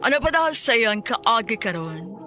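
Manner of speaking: usual speaking voice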